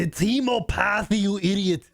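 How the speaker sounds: mock-scold in an Austrian accent; Arnold Austrian accent. Cohost mocks caller's word in an Austrian accent. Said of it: "That's Arnold".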